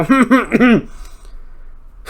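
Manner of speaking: high, sudden laugh breaking out; laughing at his own joke; burst of laughter. Real, not acted. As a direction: crack up mid-sentence.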